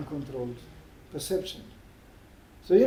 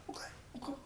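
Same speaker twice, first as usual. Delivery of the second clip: tiny whispered giving-in; barely audible mock submission; meek compliance; 9 semitones higher, 19 dB quieter, much faster than usual. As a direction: whisper it like a chastened passenger. Passenger gives in, now meekly.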